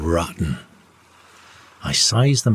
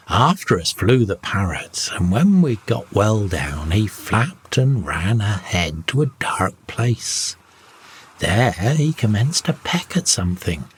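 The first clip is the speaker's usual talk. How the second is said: ordinary delivery, nothing marked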